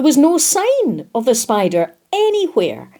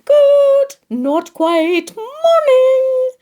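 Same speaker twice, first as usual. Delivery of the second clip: high, sung-out cheery greeting; cheery morning call; 4 dB louder, much slower than usual. A narrator's reading. Missus Goat greeting the chaotic gathering, cheery high call. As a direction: call it out high and bright, stretching the words. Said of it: "It was Missus Goat's cheery".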